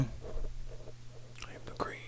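slow whispered catchphrase. Pretend. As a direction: murmur it slow with no voice.

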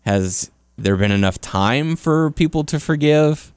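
ordinary talk

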